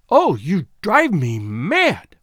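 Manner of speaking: slow, drawn-out exasperation; exasperated outburst. A narrator's reading. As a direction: cry out at the end of your patience. Exasperated outburst in acted quarrel.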